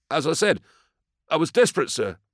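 high, pleading farmer; high-pitched distressed confession; desperate defendant. A narrator's reading. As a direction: plead your hardship in a high, urgent voice.

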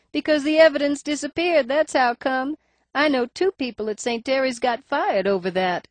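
high nasal voice, faint lisp. A narrator's reading. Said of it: "Her voice is higher ... nasal cast".

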